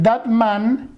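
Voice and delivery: That man is intelligent in a clear English accent; slow, careful English of That man is intelligent; English model phrase. Shown as a demonstration. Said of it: "Tú puedes decir".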